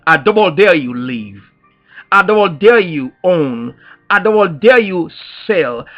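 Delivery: ordinary talk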